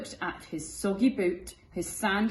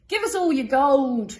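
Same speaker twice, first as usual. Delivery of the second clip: louder, higher pirate demand; pirates crying out; 7 semitones higher, 10 dB louder than usual. Acted. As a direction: demand the gold loudly. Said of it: "they cried".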